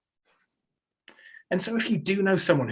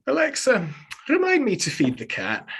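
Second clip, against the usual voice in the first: put-on calling out in a thick Scottish accent; granddad's broad Scottish burr, loud; 8 semitones higher, 4 dB louder than usual. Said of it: "Just ask my granddad".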